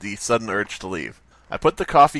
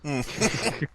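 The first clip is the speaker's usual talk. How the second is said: normal talking voice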